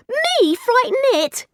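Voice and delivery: high, slow indignant squeak. Acted. Girl protesting she was the frightened one, high squeak. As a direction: squeak it out in disbelief. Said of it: "Frankie squeaked".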